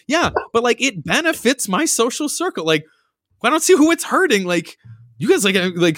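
high, breezy mock reasoning; high mock-casual friend voice; imitating Sarah. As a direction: justify it cheerfully, high. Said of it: "she literally is like".

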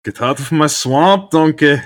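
loud, high Shrek-style Scottish rant; Scottish accent. Shown as a demonstration. Asked to say Shrek's line, loud Scottish rant. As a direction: bellow it like an angry Scottish ogre. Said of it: "lean into the Scottishness".

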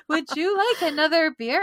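high, loud, hammed-up sweetness; fakest nice voice. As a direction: force out a badly faked polite offer. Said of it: "use the fakest voice ever".

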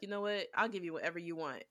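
softly pleading, mildly scared; quiet, half-serious plea for mercy; mock scared. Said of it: "I would be scared too".

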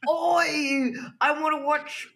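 hammed-up British accent; mock British accent. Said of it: "as good as your Australian accent".

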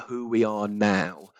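normal talking voice

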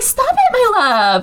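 sing-song high coo, then drop; high mock-tender scolding; mock sweet lover. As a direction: coo it sarcastically like a syrupy girlfriend. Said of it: "She's over here like".

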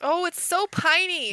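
high, loud delight over the book; squealing with book delight; gushing. Real, not acted. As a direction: gush about how piney it is. Gushing over a pining romance.

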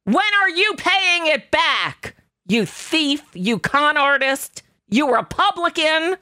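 high, accusing, slow jabs; imagine cornering her on the House floor; mock confrontation. Host acting out grilling Marge Q Green, high accusing jabs. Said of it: "and asked Marge Q Green".

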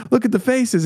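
mock them in a high, silly voice. Telling the guests how people react to the portal photo, mocking high voice.

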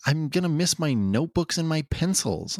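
high-pitched mock nerdy kid; whiny little bookworm's complaint; Gohan. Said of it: "He's also a nerd".

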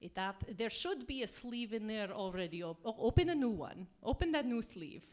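lightly put-on bad Russian accent; hammy Russian cooking host. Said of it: "speak in bad Russian accents".

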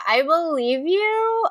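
ordinary talk, nothing marked

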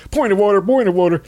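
high-pitched pestering objection; mock interrupting congressmen. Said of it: "They were interrupting him on purpose".

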